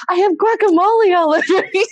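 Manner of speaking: high, loud, laughing wail; flustered excitement. Retold or imitated. Girlfriend proposed to with messy hands, flustered and laughing. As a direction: protest in giddy mock dismay.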